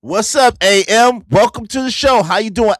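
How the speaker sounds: loud, hyped welcome; replaying his greeting. Streamer replaying how he loudly welcomed Angry Man, hyped. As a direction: greet him big, like on the stream. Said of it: "After I defended him".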